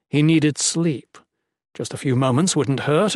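ordinary delivery

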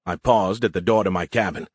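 ordinary delivery, nothing marked

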